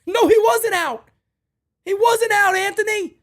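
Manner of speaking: high, loud angry yell; angry shout. Real, not acted. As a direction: shout him down. Host yelling at player on replay.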